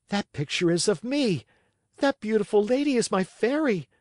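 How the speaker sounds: high, eager thrilled voice; excited recognition. A narrator's reading. Pinocchio recognizing his fairy in the box, high excited voice. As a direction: gasp it out in delighted surprise. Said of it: "said Pinocchio to himself".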